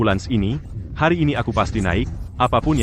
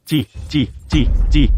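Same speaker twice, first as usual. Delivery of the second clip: disgusted spitting; 5 semitones higher, 4 dB louder, slower than usual. Acted.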